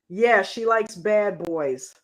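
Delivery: ordinary talk, nothing marked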